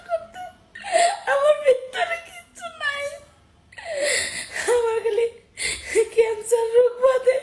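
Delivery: real crying, voice rising into wails; sobbing. Real, not acted. Telling viewers their body is finished, sobbing hard. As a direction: break down as you speak. Breaking down over ruined health.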